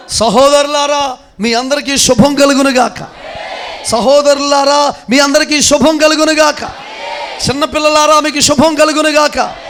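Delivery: high, loud proclamation building to a shout; full-force preaching. Acted. Preacher proclaiming blessing over the congregation, full-force shouting. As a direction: preach it at the top of your voice, stretching the key words.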